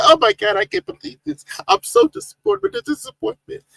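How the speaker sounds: high, mock-dismayed Samantha voice; impression of Samantha. Host mimics disappointed viewer Samantha, high mock dismay. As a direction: squeak out her disappointment. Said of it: "trying to make an impression of her voice".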